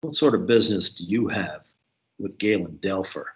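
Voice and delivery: low, deliberate questioning; deep voice. A narrator's reading. Cook questioning a visitor about Galen Delfer, deep voice. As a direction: drop the voice low to question a stranger. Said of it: "he said in a deep voice".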